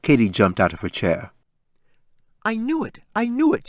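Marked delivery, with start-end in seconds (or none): 2.4-3.7 put-on high, gleeful childish cry; crowing that she was right; excited yell. Daughter jumping up, high gleeful shout. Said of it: "she yelled".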